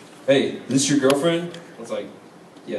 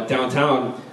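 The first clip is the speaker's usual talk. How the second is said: ordinary talk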